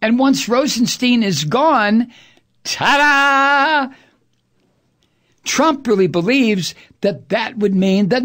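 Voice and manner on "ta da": high, held magician's reveal; mock fanfare. Host mocking the hope that Mueller vanishes, mock fanfare. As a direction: sing it out like a trick's payoff.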